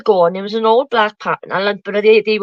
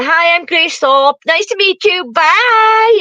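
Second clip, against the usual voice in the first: high, slow mock American greeting; pitched-up American sign-off; imitated American accent; 7 semitones higher, 4 dB louder, slower than usual. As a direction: do her American voice, rising to a loud goodbye. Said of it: "in her American".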